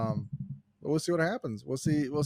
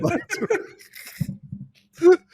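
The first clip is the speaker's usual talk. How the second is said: repeats the joke high, slow, through laughter; cracking up while echoing the phrase; laughing; 7 dB louder, much slower than usual. Real, not acted. Co-host laughing at his friend's joke, cracks up mid-word.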